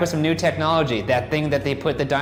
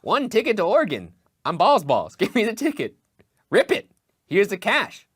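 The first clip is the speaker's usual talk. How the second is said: high, goofy clown voice; circus clown voice; 8 semitones higher than usual. Pretend. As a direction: play a silly circus clown. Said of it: "You sound like a circus clown".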